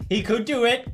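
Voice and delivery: excited insistence. Real, not acted. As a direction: repeat it with rising excitement. Repeats line, getting worked up.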